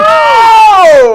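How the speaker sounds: very high, very loud yelp; a real, piercing gasp of surprise; shocked cry. Real, not acted. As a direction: cry out in stunned dismay. Reacts to the poll result.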